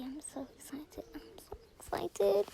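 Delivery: unvoiced, soft whisper of joy; excited whisper. Real, not acted. Gamer whispers to viewers before opening the reward, excited. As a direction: whisper it like you can barely contain it.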